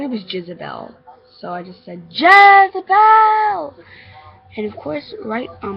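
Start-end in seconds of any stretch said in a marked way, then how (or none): 2.1-3.7 high, long-held loud call. Acted. Child calls out for the missing little girl, loud calling. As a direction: holler the name JEZEBEL, stretching it out. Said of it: "I had called what I thought her name was".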